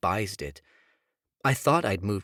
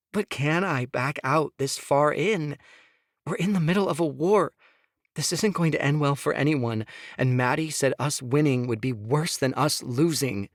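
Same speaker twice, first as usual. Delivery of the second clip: higher, pleading woman's voice; Sophia pleading; 6 semitones higher, 3 dB louder than usual. Acted. Wife begging husband to hear her, higher pleading. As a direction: plead with him, voice pitched up.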